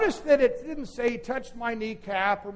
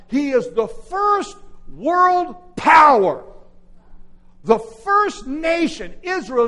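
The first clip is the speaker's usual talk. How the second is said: louder, slower, every word hammered home; slow emphatic shout; 6 semitones higher, 10 dB louder, slower than usual. Acted. Preacher declaring David's power to the church, slow shout. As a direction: proclaim it like a banner. Preacher's climax over music.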